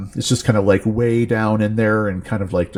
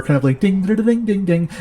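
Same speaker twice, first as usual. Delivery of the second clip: dulcimer dings; 10 semitones higher than usual. Retold or imitated. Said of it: "It's the sound of a, a, a dulcimer".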